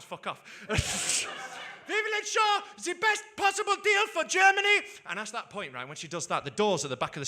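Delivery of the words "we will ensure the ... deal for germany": high, slow mock German accent; Angela Merkel. Comedian as chancellor promising parliament a deal, mock German accent. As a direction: make a comic German-accented speech, pitched high. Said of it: "That's how she sounds".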